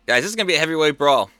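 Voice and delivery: normal talking voice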